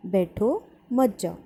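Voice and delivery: slow, with "बैठो" set apart from "मत जाओ"; pause after बैठो. Shown as a demonstration. Teacher repeats first sentence for students, pausing after "बैठो". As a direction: say "बैठो", stop briefly, then "मत जाओ". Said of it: "बैठो के बाद अल्प विराम आया".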